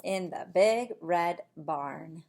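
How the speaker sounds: slow, hushed bedtime storytelling; sleepy bedtime reading. Acted. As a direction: lull them toward sleep. Bedtime ending of the book.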